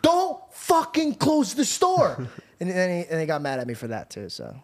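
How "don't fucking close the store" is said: high-pitched imitation of an exasperated dad; furious scolding. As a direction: scold in mock fury, slowing on the final order. Acted furious dad scolding son.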